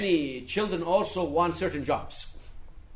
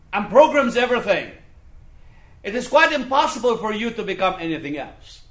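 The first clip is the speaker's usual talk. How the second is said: loud sermon warning; emphatic preacher driving the warning home; forceful preaching; 5 semitones higher, 10 dB louder than usual. Real, not acted. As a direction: preach it hard, punching the key words.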